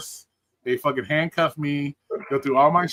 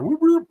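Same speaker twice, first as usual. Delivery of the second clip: quick rising "whoop" of a siren; siren imitation; 14 semitones higher, 5 dB louder than usual. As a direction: mimic a police siren whooping. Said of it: "I hear the siren behind me".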